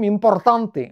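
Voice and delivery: put-on Spanish accent with strong rolled r; mimicked Spanish accent. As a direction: imitate a Spaniard stressing every r. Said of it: "রটাকে জোর দিয়ে বলে".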